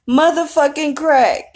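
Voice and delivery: high, strained imitation of a sobbing husband; mimicking the husband's teary wail; imitating Clint's crying. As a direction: squeeze it out high and slow like a broken man. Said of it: "That's how he said it".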